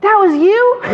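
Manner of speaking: gasp, then high incredulous question; high-pitched retold astonishment; shocked realization. Seller realizing who left flyers, shocked.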